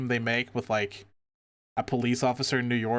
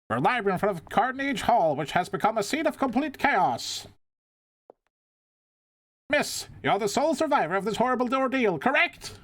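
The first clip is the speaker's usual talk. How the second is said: high, eager TV reporter; news reporter voice; 9 semitones higher than usual. Acted. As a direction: play the pushy live reporter.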